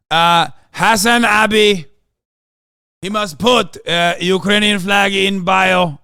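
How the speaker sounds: loud, slow put-on accent with uh pauses; Zelensky impression. Zelensky urging flags in bios, in a loud mock accent. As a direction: shout it in a thick mock accent, slowly. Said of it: "Why is he French?".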